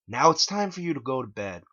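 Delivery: movie villain line. Acted. Movie quote.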